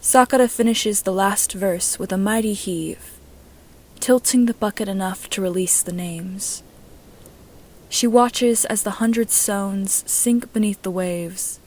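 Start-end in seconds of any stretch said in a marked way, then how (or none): none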